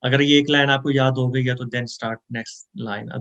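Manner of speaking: ordinary delivery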